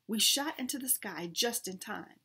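usual speaking voice

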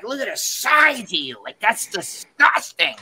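mock blasting. Women blasting the short contestant, appalled mock disgust. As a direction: imitate their disgust, dragging out the insult. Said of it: "they started blasting me".

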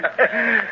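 a quick high chuckle; short chuckle. Acted. Clint chuckles as Barney refuses the meat, short laugh.

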